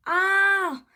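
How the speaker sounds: loud, high drawn-out yell; narrated scream, voice leaping up high. A narrator's reading. Teddy sees floating bones coming at them, loud high yell. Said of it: "yelled Teddy".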